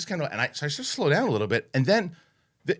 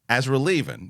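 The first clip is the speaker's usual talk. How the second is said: normal talking voice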